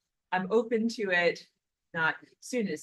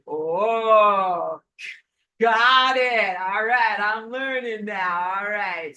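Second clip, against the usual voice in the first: louder, slowed, cheery got it; eager realization; 8 dB louder, much slower than usual. Retold or imitated. She accepts Rosa's correction, loud and cheerful. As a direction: happily accept the lesson out loud. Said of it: "And I was like".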